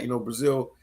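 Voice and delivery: ordinary talk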